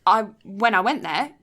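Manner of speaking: ordinary delivery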